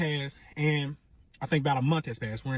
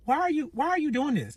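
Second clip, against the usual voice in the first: high-pitched, incredulous retelling; retold disbelieving question; 13 semitones higher than usual. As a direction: replay your bewildered challenge in a high voice. Retold confrontation with the ex.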